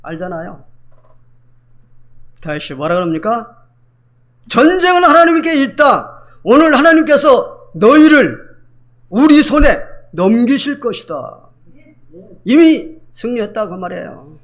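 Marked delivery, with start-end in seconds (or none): 4.5-11.4 high, loud and slow, declaring victory; bold proclamation. Acted. David declaring God's victory to Goliath, loud, slow and high. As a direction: proclaim it like David before battle.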